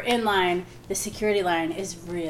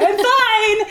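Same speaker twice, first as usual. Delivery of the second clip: much higher, louder forced cheer with a laugh; forced high I'm fine; 14 semitones higher, 10 dB louder than usual. Retold or imitated. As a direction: pretend all is fine, bright and strained. Covering up, mock cheer.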